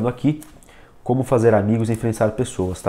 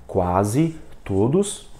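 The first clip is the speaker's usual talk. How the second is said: very slow, QUASE and TODOS split by a pause; word by word; 2 semitones lower, much slower than usual. Shown as a demonstration. Teacher shows the slow way to avoid, word by word. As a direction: read each word separately, dragging it out. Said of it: "em vez de você ler".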